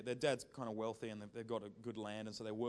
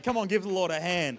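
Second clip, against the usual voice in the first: usual speaking voice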